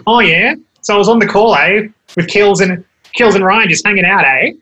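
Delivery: hammed-up Australian twang, each phrase ending up like a question; Australian accent. Shown as a demonstration. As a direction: lay on a thick nasal Australian accent that turns everything into a question. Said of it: "you gotta go a bit more nasal, so, like, you can turn it on".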